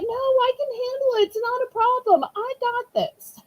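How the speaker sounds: high-pitched quote of an unfazed helper; imitating volunteer helper. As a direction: mimic her brushing off the worry, pitched well up. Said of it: "And she's like".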